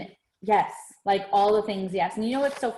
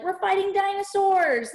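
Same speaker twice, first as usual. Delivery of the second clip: high, drawn-out make-believe cry; excited young son; 10 semitones higher than usual. Retold or imitated. Imitating movie son playing along with dad's story, excited. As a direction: play a kid thrilled by the game. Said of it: "his son, like, is literally like".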